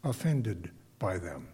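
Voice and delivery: normal talking voice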